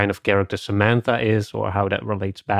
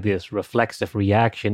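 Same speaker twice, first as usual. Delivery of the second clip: normal talking voice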